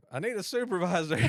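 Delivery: high, loud mock yell with a laugh in it; half-laughing yell of a man demanding a supervisor; retold hollering. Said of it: "I was hollering at that point".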